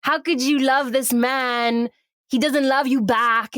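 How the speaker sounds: slow, hammed-up English accent. Smoking friend questions Iris about Jasper, mock English accent. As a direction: scold slowly in a put-on English accent. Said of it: "We're in England".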